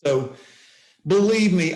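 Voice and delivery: normal talking voice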